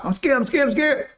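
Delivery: the heart voiced high and fearful, asking the mind for help; frightened heart voice. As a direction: play the scared heart crying out to the mind. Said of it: "See, that's the heart".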